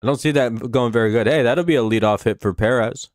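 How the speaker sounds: normal talking voice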